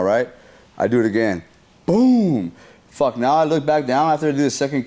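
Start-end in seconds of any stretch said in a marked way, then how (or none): 1.9-2.5 high, falling boom; emphatic storytelling impact noise; imitated kick. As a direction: punch out a big door-kick boom.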